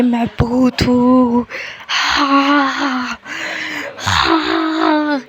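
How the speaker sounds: slow, loud disguised deep voice. Pretend. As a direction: drag out a loud heavy ghost boast. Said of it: "ज़ोर से आवाज़ करके बोलता है".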